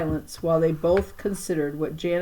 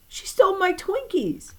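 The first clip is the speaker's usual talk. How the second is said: whiny complaint; 14 semitones higher than usual. Acted. Wronged child complaining again.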